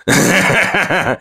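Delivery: high, cracked, unhinged laugh; hysterical laugh. A narrator's reading. Broken hunter laughing at Una, hysterical laugh. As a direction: laugh like he's losing control. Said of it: "Смех у него вышел истеричным".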